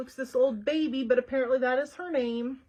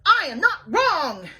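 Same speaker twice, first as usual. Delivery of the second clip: much louder, slow and insistent; indignant shout; 5 semitones higher, 10 dB louder, slower than usual. Acted. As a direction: refuse to be contradicted. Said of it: "Eugenia shouted".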